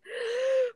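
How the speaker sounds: sharp high mock gasp; a hammed-up gasp of shock. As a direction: gasp as if caught off guard. Retold bladder gives way.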